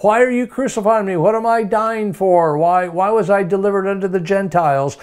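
high, pleading questions in mock confusion; mock bewildered Jesus. Preacher voicing a wrongly confused Jesus, high mock bewilderment. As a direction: play it as if he had no idea why. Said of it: "That would be so unscriptural to say".

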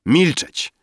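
high-pitched order for silence; imperious command. A narrator's reading. Drunk Rafael threatening Emil, high imperious command. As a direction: cut him off imperiously.

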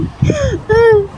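short high squeal of laughter. Real, not acted. Laughing fit during challenge. (matinis na tawa)

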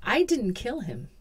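ordinary delivery

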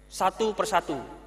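ordinary talk

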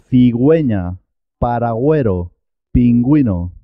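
"cigüeña, pingüino" as thee-GWEH-nya, peen-GWEE-no; sounded U with diaeresis. Shown as a demonstration. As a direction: clear W glide after G in "cigüeña, paragüero, pingüino". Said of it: "la U se, se escucha, suena".

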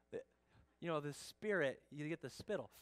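usual speaking voice

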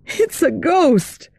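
high, slow frightened cry. Acted. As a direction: gasp it out in fright. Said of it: "Jeanne cried".

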